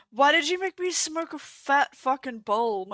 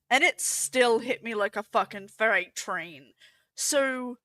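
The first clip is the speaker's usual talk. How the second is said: usual speaking voice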